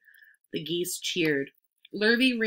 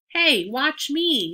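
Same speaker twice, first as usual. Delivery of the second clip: put-on loud, high, drawn-out boyish yell; show-off yell; 8 semitones higher, 6 dB louder, much slower than usual. A narrator's reading. Boy crawling into the crate, show-off yell. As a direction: yell slowly for everyone's attention. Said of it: "yelled Avery".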